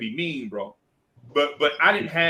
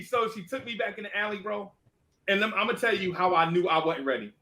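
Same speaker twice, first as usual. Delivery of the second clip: ordinary talk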